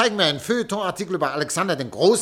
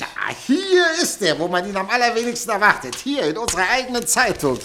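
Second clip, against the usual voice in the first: high, loud, rushing with excitement; can't contain the sensation; excited; 4 semitones higher, 4 dB louder than usual. Acted. Editor thrilled by the scoop.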